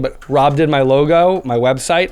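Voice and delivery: usual speaking voice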